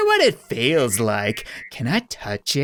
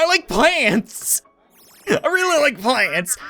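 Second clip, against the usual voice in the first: high female voice; 9 semitones higher than usual. Acted. Game character voice.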